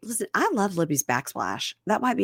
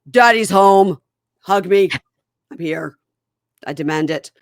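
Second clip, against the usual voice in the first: loud, brash "Daddy's home" call; mock loud husband; 2 semitones higher, 10 dB louder than usual. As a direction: announce yourself loudly as the returning dad.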